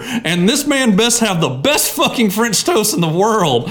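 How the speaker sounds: usual speaking voice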